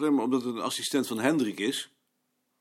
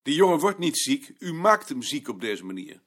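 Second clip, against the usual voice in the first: louder and higher, pushing back hard; heated protest; 5 semitones higher, 5 dB louder than usual. Acted. Colleague confronts the director over the assistant, louder and heated. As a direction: defend the boy with rising heat.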